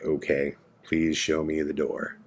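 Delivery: low, very soft acceptance; retold meek reply. Released kid to officer, softly meek. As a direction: answer small and subdued, imitating submission.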